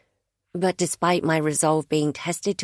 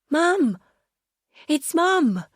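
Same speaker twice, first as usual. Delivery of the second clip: put-on excited little boy calling out, high and loud; boy's shout; 13 semitones higher, 3 dB louder, slower than usual. A narrator's reading. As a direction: shout it over your shoulder like a boy. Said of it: "I heard the muffled shout".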